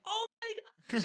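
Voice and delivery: high, hushed starstruck squeal; imitating an awestruck fan reaction. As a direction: re-enact a thrilled fan, soft and way up high. Retelling a starstruck fan moment.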